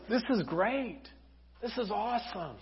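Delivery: soft, quiet wonder; hushed delight. Acted. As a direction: softly marvel at wonderful news. Mary quietly accepts the news.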